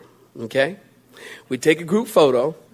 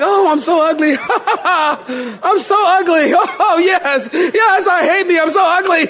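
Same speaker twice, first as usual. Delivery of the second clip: gleeful mock delight; 10 semitones higher than usual. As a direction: crow happily about being ugly, laughing. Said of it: "You would be like".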